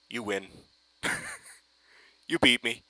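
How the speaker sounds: normal talking voice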